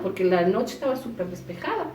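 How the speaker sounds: ordinary delivery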